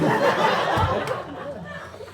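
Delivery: airy giggle burst; loud wheezy laugh; breathy laughter. Real, not acted. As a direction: crack up. Laughs after "really nice.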